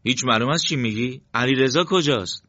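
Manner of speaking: astonished, pressing for an answer. Acted. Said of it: "مرتضی بیشتر از پیش متعجب شد".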